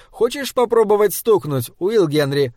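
playful offer. A narrator's reading.

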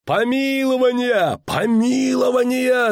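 high, drawn-out chanting cry; crowd's roar. A narrator's reading. Crowd on the square at news of pardon, roaring. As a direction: howl it long and high like a crowd. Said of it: "зарычала толпа".

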